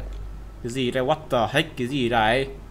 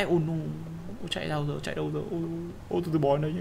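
much softer frightened murmur; scared hush; 11 dB quieter, much faster than usual. Real, not acted. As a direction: hide and panic quietly. Fleeing monster, hushed. (sợ hãi thì thầm)

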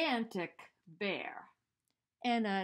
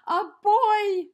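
very loud, higher yell; 10 semitones higher, 18 dB louder than usual. A narrator's reading. The bear sees Eddie, very loud startled yell. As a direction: shout out in shock. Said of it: "yelled the bear".

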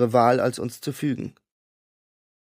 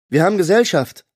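loud, higher warning call; announcing; 6 semitones higher, 7 dB louder than usual. A narrator's reading. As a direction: announce the danger to everyone. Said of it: "verkündete Holly".

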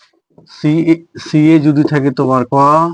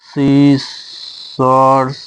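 "সিসর্স" stretched very long in two parts; "সিসর্স" very slow, split in the middle; sounded out slowly; much slower than usual. Shown as a demonstration. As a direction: draw out each syllable of "সিসর্স". Sounds out word while writing it.

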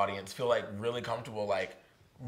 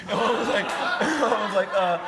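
real laughter breaking through, higher and louder; laughing; 10 semitones higher, 9 dB louder than usual. Real, not acted. Retelling his mom's reaction to his tattling, laughing out loud. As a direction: laugh through the words, can't hold it in.

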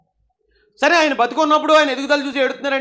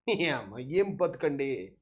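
mock lament; 10 semitones lower, 11 dB quieter, much slower than usual. Sarcastic lament at rival.

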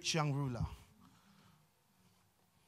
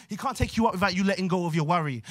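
ordinary talk

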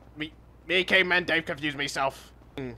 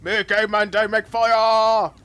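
higher, louder, slow caveman boast; loud proud caveman; 8 semitones higher, 7 dB louder than usual. Acted. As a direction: declare it triumphantly. Repeats line louder and higher.

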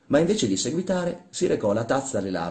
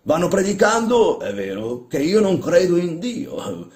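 high, loud mocking complaint; scornful; 9 semitones higher, 6 dB louder than usual. Old man complaining of priests to workman, scornful. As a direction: report their preaching with scorn.

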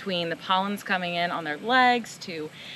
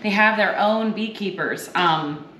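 louder, bright show-host read presenting the spirit; upbeat cocktail demo host; 4 dB louder than usual. Acted. Host to viewers, introducing Bar Hill cocktail, louder upbeat presenter read. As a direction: sell it like a cooking-show host.

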